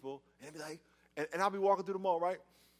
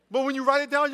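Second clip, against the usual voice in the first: ordinary talk, nothing marked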